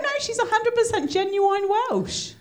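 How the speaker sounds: high-pitched retold protest; mock-insistent retort. Host retelling defence of guest's accent, high and insistent.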